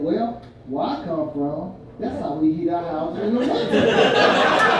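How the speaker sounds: slow, dry doctor's punchline; slow deadpan. Retold or imitated. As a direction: drag it out, dead serious.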